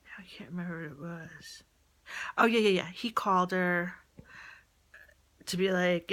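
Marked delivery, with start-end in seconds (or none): 0.0-1.6 whisper. Real, not acted. Forgetting mid-story, aside.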